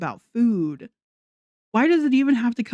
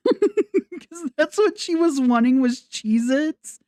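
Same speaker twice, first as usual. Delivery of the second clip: laughing through the words, slow; giggling while mocking; 6 semitones higher, slower than usual. Real, not acted.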